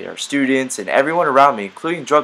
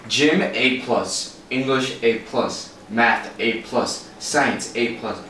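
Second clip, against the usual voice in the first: slow reading; much slower than usual. Acted. Dad reads report card slowly.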